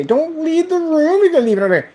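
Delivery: pleading cartoon squeak; high mock voice. High put-on voice.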